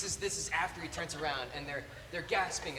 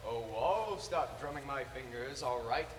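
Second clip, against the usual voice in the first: mock-villain delivery, slow and drawn out; quoting ex-girlfriend's routine; 7 dB louder, slower than usual. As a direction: imitate the cartoon threat, swelling on FOREVER. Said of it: "And she'd go".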